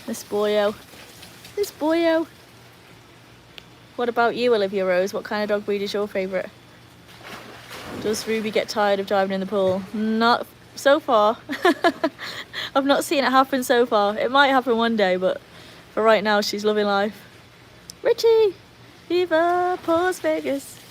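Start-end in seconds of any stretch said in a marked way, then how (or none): 1.6-2.3 higher-pitched pet-talk voice; doting coo. Real, not acted. Owner fondly talking to dog, doting coo. 18.0-20.8 high, drawn-out cheer; excited shout-out. Real, not acted. Streamer hailing a viewer, excited shout-out.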